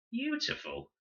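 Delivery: very high disbelieving echo; incredulous. A narrator's reading. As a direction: throw the word back in disbelief. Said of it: "Con stared incredulously".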